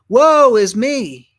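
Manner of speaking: slow, loud and high, a wail of dread; drawn-out cry of despair; anguished cry. Acted. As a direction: cry it out like a man undone before God. Isaiah cries out before throne.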